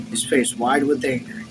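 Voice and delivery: usual speaking voice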